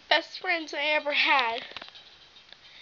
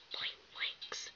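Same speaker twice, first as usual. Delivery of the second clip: breathy unvoiced jeer under the breath; whispered taunt; 19 dB quieter than usual. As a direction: whisper the insult sneakily.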